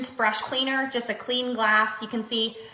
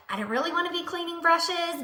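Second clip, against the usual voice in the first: reluctant whine; 7 semitones higher than usual. Retold or imitated. To viewers, imitating a lazy excuse, mock whine. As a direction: lightly act out not wanting to bother. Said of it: "You're like".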